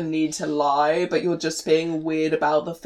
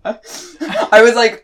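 laughing through the words, louder; breaking into real laughter mid-sentence; 4 semitones higher, 8 dB louder, much slower than usual. Real, not acted. Laughing at own bad accent.

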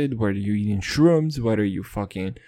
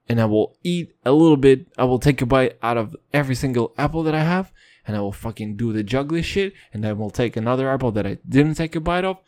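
rushed proclamation; faster than usual. Pretend. Fast louder declaration.

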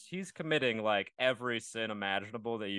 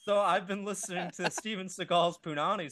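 laughing; 5 semitones higher than usual. Real, not acted. Laughing confession.

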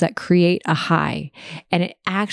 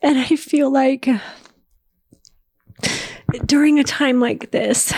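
crying; 7 semitones higher than usual. Real, not acted. Tears over missing Dave.

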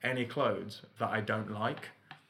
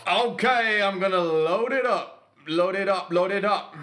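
higher, louder pumped-up chant; excited hype; 7 semitones higher, 6 dB louder than usual. Real, not acted. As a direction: psych yourself up like a countdown. Hyping up her turn.

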